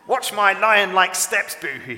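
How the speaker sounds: loud, high boastful roar. A narrator's reading. Toto showing off his lion steps to Boohoo, roaring. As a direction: draw it out big and loud, like a proud lion. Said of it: "he roared".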